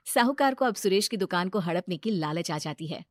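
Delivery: ordinary delivery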